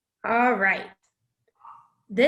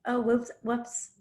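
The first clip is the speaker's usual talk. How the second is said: slow, softer sheepish oops; mock sheepish whoops; 5 dB quieter, slower than usual. Candidate bailing on a company, sheepish mock. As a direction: shrug it off slowly. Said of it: "at the end you're like".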